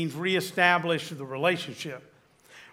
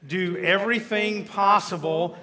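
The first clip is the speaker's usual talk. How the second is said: slow, louder congregational reading of "Do everything possible"; reading scripture aloud together; 2 semitones higher, 5 dB louder, slower than usual. Shown as a demonstration. Pastor leads the room in reading the verse, slow and firm. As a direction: read it out together, slowly and firmly. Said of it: "Let's read that together".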